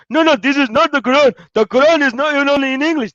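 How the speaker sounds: loud, high imitated objection rattling fast; mock crowd protest. Mimicking the Muslims rejecting the translation, loud and high. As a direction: play an exasperated crowd shouting him down. Muslims arguing in retold debate.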